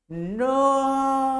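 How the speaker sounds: high, loud terrified scream; high loud horrified shout. Retold or imitated. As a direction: scream in horror at the blood. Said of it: "Y él grita".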